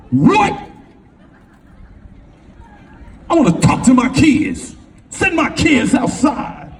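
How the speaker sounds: put-on furious father orders kids outside; angry father. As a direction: demand it like an outraged dad. Father losing his mind.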